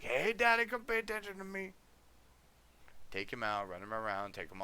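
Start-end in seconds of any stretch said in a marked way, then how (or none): 0.0-1.7 much higher, louder needy dog voice; voicing the dog. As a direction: whine for Daddy's attention like the pup. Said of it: "he's like".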